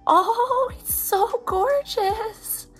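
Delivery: high, drawn-out adoring squeal; gushing adoration. Real, not acted. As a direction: coo slowly, way up high, in awe. Gushing over a beautiful product.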